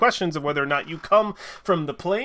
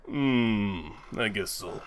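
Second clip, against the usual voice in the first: grudging; 7 semitones lower, 6 dB quieter, much slower than usual. Acted. Grudging reply of gruff smith.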